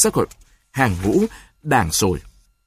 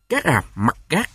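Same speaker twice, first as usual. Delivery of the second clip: through gritted teeth; 3 semitones higher than usual. Acted. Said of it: "Đoàn nghiến hai hàm răng lại".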